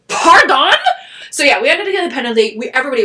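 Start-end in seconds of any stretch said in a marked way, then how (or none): 0.1-0.7 indignant disbelief. Retold or imitated. Indignant at an unfair penalty call.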